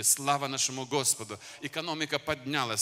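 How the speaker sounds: normal talking voice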